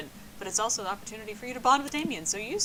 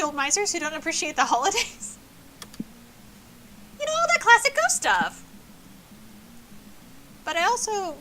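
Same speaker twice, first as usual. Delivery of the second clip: put-on higher chirpy party girl voice; bubbly ghost girl; 4 semitones higher than usual. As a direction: gush like a lovestruck ghost. Polly voice continues.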